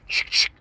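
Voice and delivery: sharp double "ch-ch" noise; imitated gun racking. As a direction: mimic a pump shotgun being cocked. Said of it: "So then he goes".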